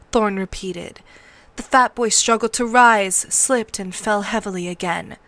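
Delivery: usual speaking voice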